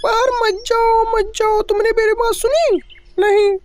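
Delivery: high-pitched mother, a touch of scolding. Acted. Mother scolds Kavita for going out in the cold, high and reproachful. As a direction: tell her off for disobeying.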